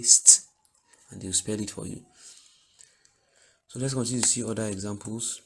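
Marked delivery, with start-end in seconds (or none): 0.0-0.4 a loud "St" blend; child calling out "St"; pupil sounding blend. Retold or imitated. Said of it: "They'll say".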